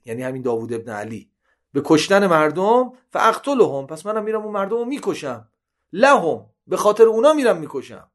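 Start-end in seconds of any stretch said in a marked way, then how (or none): 1.7-8.1 a raised, higher pleading cry of a condemned man. Retold or imitated. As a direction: voice Sirafi's protest before his execution, lifted and urgent. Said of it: "به قول ما داد و فریاد کردن".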